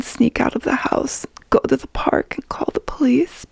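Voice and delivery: whispered secret plan; hushed. A narrator's reading. Narrator whispering her secret escape plan. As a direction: breathe it like a secret.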